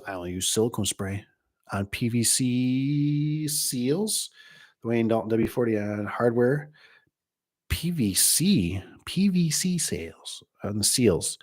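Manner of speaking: a light, slowed-down take on Mister Ben's sales line; a hint of Mister Ben, slowed and teasing; imitating Mister Ben. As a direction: drawl the seals part out slowly, lightly mocking. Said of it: "Mister Ben says".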